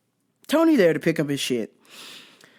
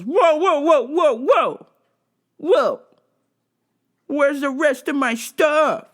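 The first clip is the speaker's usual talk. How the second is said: high, loud whoa whoa, then slowing down; hammed-up indignant guy demanding his order; imitating a pushy customer's outrage; mock outraged customer; 8 semitones higher, 4 dB louder, slower than usual.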